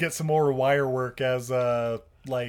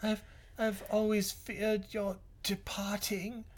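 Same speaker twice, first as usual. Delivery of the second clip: soft dying whisper; 4 semitones higher, 11 dB quieter, slower than usual. Acted. Quoting dying line.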